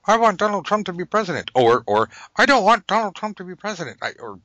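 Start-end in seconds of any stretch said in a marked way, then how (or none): 0.1-1.5 high, fast mock wish; high fast pretend wish. 2.4-4.0 high, fast mock counter-wish; high fast pretend counter-wish.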